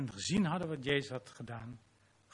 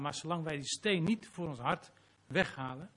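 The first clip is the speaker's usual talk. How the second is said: ordinary talk, nothing marked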